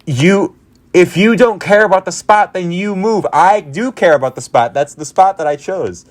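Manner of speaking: high-pitched, emphatic retort; indignant replayed comeback; heated argument. Acts out arguing over the spot.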